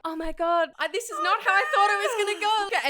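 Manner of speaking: high, loud, fast mock panic; hammed-up alarm, pitched high; acted panic. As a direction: blurt it out like a plan gone wrong. Acts out his panicked thoughts.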